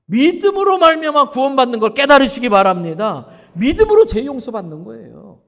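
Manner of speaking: loud, high sermon climax; full-force preaching. Acted. Preacher urging the congregation, loud and fervent. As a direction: drive the point home to the congregation.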